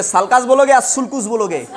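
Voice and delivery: first sulcus as "salkas", second sulcus as "sulkus"; contrasting two pronunciations. Shown as a demonstration. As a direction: ask which one: sulcus with "a" vowels, then sulcus with rounded "oo" vowels. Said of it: "कैसे pronounce करोगे".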